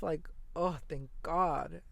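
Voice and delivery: usual speaking voice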